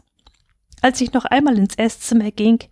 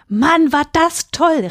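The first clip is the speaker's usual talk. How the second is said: high, drawn-out cry of delight; excited call; 9 semitones higher, 4 dB louder, slower than usual. A narrator's reading. Children return from the forest, calling out delightedly. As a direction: exclaim it slowly, thrilled, pitched right up. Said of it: "riefen wir".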